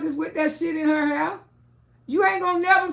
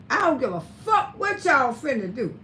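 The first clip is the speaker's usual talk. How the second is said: normal talking voice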